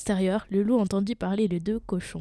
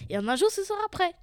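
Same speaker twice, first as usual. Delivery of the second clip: high-pitched, pleased pig voice; lazy pig boasting in a high voice; satisfied little pig; 10 semitones higher, faster than usual. Acted. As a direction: pipe up high, pleased with yourself. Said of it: "dit-il, satisfait à ses frères".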